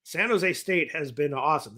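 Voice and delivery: usual speaking voice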